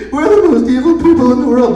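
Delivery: high, loud fake-crying villain; hammed-up sobbing boast; mock tearful whine. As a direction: blubber it like an emo villain. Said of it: "All these emo Sith Lords".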